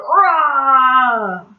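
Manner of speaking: loud, drawn-out mock roar; big playful roar; lion roar. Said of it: "The lion is loud".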